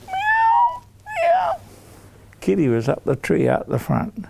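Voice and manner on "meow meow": very high plaintive meow; sad meowing. Acted. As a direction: mew like a stuck kitten. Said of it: "the saddest sound he's ever heard".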